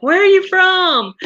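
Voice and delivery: high, loud, abrupt local asking where she's from; curious stranger. Retold or imitated. A Rhode Islander stopping her mid-story, loud high question.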